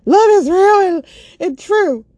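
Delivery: high, loud tearful yell; yelling in tears. Retold or imitated. She yells nonsense at her friend through tears, high and loud. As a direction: cry it out at the top of your voice. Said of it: "I find myself yelling. I'm in tears".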